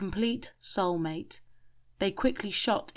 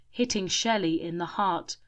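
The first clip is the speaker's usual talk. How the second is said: usual speaking voice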